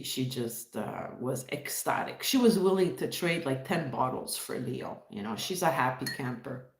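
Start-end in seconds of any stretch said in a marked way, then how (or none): none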